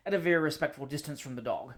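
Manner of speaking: ordinary delivery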